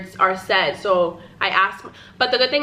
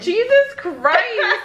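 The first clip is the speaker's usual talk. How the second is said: laughing; 12 semitones higher, much slower than usual. Real, not acted. Recovering from laughing fit.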